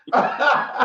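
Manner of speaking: high laugh. Real, not acted. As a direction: laugh at the joke. Laughs at own joke.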